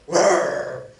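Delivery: a loud, growly mock tiger roar. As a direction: roar at them like the tiger did. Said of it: "He roars at me".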